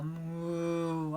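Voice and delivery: long, droning moo; cow moo. Acted. Cow answering the hen, mooing.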